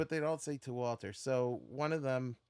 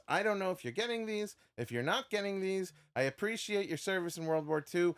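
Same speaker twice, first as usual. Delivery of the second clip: higher, emphatic quoting of a firm letter; mock fed-up; 6 semitones higher, 6 dB louder than usual. Astronaut writes to repeat autograph seeker, mock exasperated. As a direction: lay down the law, pitch raised.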